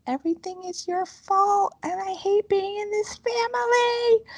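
high-pitched, drawn-out tantrum wail; imitated child's scream. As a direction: mimic a screaming child without full volume. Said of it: "screamed at the top of her lungs".

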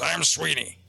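creepy growled self-introduction, imitated; growling ghost. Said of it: "you hear this growl".

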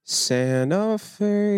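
high, very drawn-out echo; playful slow-motion echo; mocking echo. Playfully echoing a correct answer.

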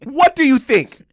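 high, loud, slow shouting; angry yell. Retold or imitated. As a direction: yell it at dad in disbelief. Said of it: "I went".

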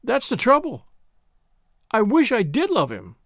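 sobbing out her wish; crying through sobs. A narrator's reading. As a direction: cry it out, near tears. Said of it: "from the midst of her sobs she cried".

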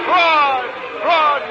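high, loud furious crowd yell. Acted. Maddened thousand in the stands yelling, furious. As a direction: shout in anger like a whole stadium. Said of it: "yelled the maddened thousand".

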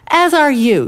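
high, loud comeback; acted indignant yell; yelled retort. A narrator's reading. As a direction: throw it back with a story-time yell. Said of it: "yelled the second".

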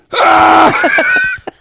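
high, loud burst of real laughter; loud shrieking laugh. Real, not acted. Laughing at a friend's surprised question, shrieking laugh. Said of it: "That's funny".

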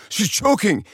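high alarmed dad voice; mock panicked father; imitating dad panicking. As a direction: sound the alarm, pitched high. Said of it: "my dad's like".

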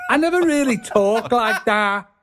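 high, lilting Scouse; Liverpool accent. Shown as a demonstration. Denying he ever talked that way, high Scouse accent. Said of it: "go up at the end of sentences like that".

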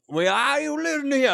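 Southern drawl. Acted. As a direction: lean hard into the twang. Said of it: "Get it back to normal".

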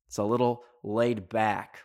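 ordinary talk

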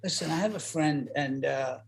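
low, hushed, halting admission; acted awkward confiding in a friend; sheepish confession. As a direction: confide it quietly, as if embarrassed.

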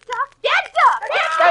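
high, loud eager guessing; excited shout. Real, not acted. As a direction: blurt out the answer excitedly. Guessing charade, calling out excitedly.

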